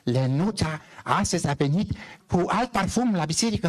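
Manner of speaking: high, put-on voice of a stubborn old woman; old village woman. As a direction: play the grumpy old parishioner. Old parishioner woman answers priest. (bătrână încăpățânată, voce înaltă prefăcută)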